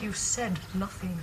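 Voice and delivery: low, slow, softly stunned accusation; quiet reproach. Acted. Woman to cousin who hid his marriage, quiet reproach. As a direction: say it slowly, barely aloud. Hurt reproach, repeated louder.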